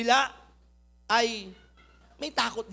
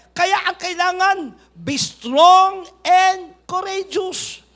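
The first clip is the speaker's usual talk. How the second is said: loud, higher, very slow proclamation; preaching at full force; 4 semitones higher, 8 dB louder, much slower than usual. Acted. Pastor rallying the congregation, loud and drawn out. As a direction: stretch each word out like a rallying cry.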